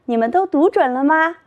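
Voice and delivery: usual speaking voice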